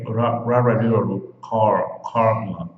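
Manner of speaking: ra, ra, ra with the R at the front, not in the throat; repeated front-R syllables building to Carla; front R drill. Shown as a demonstration. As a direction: drill ra and car with a tongue-tip R. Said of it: "O R em inglês é falado aqui na frente".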